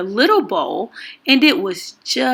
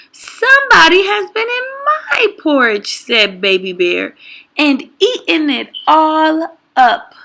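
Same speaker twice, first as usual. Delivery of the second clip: put-on high wailing Baby Bear; 7 semitones higher than usual. A narrator's reading. Baby Bear discovers empty bowl.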